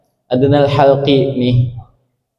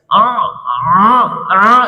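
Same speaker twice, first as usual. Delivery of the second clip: suara sengau; 3 semitones higher, much slower than usual. Shown as a demonstration. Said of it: "jangan tekan hidung".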